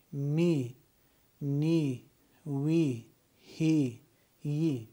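each letter said with a long ee, one by one; letters with kasra. Shown as a demonstration. Teacher drilling the alphabet with kasra.

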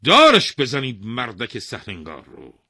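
loud, firm order to hang him; king ordering the executioner to hang the man; commanding the executioner. Acted. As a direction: command the hanging. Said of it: "خطاب به جلاد گفت".

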